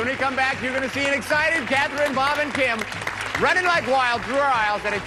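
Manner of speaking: high-pitched game show hype; excited host. Real, not acted. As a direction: build excitement before the break, pitched high. Host hypes teams before the break.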